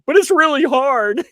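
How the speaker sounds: put-on high, loud childish whine; daughter's whiny voice. Mom voicing her upset daughter, high childish whine. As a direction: whine it like a frustrated little girl. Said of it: "she's like".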